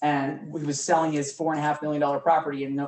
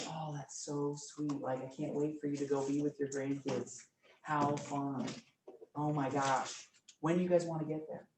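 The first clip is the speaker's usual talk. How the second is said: calm sweet voice; 10 dB quieter than usual. Retold or imitated. Chatting with a sweet old couple, soft and tender. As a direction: gush gently like to sweet grandparents. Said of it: "talking with a very sweet old couple".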